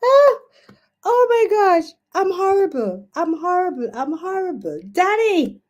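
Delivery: high-pitched guilty wail, easing off; dismayed wailing. Real, not acted. Host laments letting her friend Danny down, high guilty wail. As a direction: wail in comic self-reproach.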